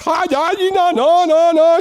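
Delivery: high imitation of a masseuse waving him off; imitating the masseuse. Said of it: "She's like".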